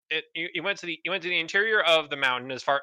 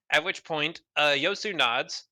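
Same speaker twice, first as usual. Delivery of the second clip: ordinary delivery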